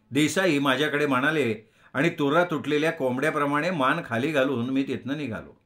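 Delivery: plain reading voice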